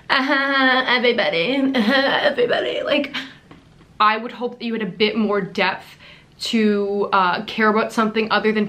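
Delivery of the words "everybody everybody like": a light airhead impression, giggling; mocking Lundy. Mocking the influencer's video intro, lightly ditzy and laughing. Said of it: "she literally sounds like she doesn't even have three brain cells".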